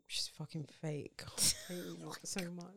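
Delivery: hushed, under-the-breath grumble; mock-bitter whisper. Podcaster griping at fake fans, mock-bitter whisper. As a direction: whisper it as a pretend grievance.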